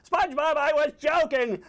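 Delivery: high, exasperated cartoon shout; angry outburst. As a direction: yell over the screaming to be heard. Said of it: "now Squidward's getting angry".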